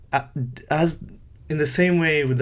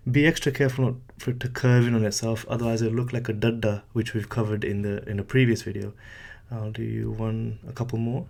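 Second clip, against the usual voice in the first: ordinary talk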